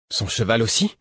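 dismayed question rising at the end; understated shocked question; mild exclamation. A narrator's reading. Woman learning the horse died too, mildly exclaiming. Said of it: "s'exclama Mercureuil".

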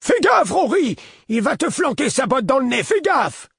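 put-on high, loud cracked old voice shouting a warning; cracked old man's cry. A narrator's reading. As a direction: cry out like a frail old man. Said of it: "s'écriait d'une voix cassée". (cri d'un vieil homme à la voix cassée)

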